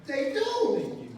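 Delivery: put-on a high little-girl question. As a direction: squeak it out like a small girl. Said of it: "She was like".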